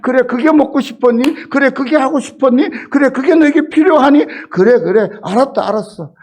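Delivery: fervent fatherly voice of God. Retold or imitated. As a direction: answer like an eager, indulgent father. Voicing God answering his child.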